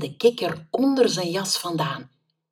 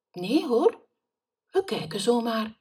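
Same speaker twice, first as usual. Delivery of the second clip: innocent denial; 6 semitones higher than usual. Acted. Boy bluffs to shopkeeper.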